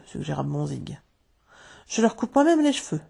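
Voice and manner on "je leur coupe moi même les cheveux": high-pitched earnest plea; pleading. A narrator's reading. Wendy pleads with her father for the boys, high pleading voice. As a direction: argue their case, voice lifted high. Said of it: "plaida Wendy".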